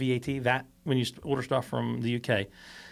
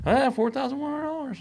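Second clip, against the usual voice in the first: mock driver demand; 12 semitones higher than usual. Driver demanding money at the door.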